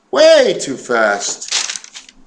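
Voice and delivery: drawn-out emphasis. Real, not acted. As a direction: drag out "Way too fast" with a big swoop on WAY. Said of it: "I'm talking way too fast".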